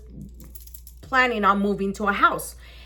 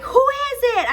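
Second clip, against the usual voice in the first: high sing-out through the door; calling out; 13 semitones higher, 4 dB louder than usual. Retold or imitated. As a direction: call to whoever is knocking. Calling through her front door.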